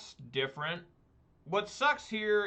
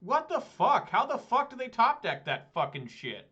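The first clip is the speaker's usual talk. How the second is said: higher, louder furious disbelief; angry outburst; 8 semitones higher, 4 dB louder than usual. Real, not acted. Player cursing at opponent's lucky draw, furious. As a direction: spit it out, outraged at the luck. Opponent top-decks the answer.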